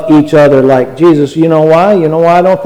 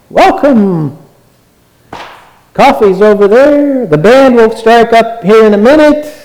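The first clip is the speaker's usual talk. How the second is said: mocking greeter; 7 semitones higher than usual. Mocking hollow church greeting.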